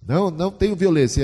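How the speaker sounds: mock parental insistence; parent's protest. Quoting parents' instruction.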